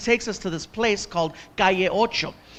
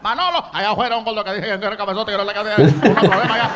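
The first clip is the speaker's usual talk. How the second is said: fast rattled Spanish gossip; trash-talking waiter; much faster than usual. Retold or imitated. Waiter griping to the cook Manolo, a fast gossipy rant. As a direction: rattle it off fast behind his back. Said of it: "starts talking trash to one of the cooks".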